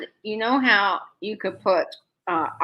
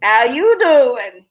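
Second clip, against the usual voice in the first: slow, louder drawn-out catchphrase attempt; botched pick-up line, stretched out slowly; Joey; 5 dB louder, much slower than usual. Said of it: "how Joey says it on Friends".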